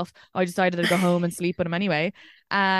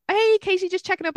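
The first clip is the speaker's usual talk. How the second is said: higher, put-on chirpy greeting; voicemail impression; 12 semitones higher, 4 dB louder than usual. Woman acting out a persistent man's voice message, mock-friendly. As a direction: imitate an over-friendly voice message.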